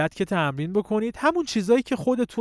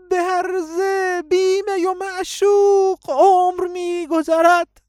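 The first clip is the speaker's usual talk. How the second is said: much higher, louder and slow; very thin high voice; 13 semitones higher, 7 dB louder, slower than usual. Shown as a demonstration. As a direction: push the voice up into a thin falsetto.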